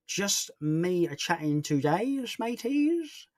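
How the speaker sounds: slow, drawn-out, leaping high on MATEYS; mock-jaunty slow singsong with a high finish; silly put-on voice. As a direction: stretch it out playfully, swooping up at the end. Playful put-on repeat of answer.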